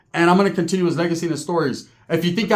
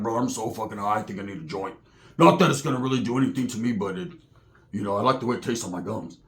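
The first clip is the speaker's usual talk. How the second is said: much lower and softer, stoned mumble; stoned Roach; 8 semitones lower, 5 dB quieter than usual. Retold or imitated. Roach voiced very low, stoned.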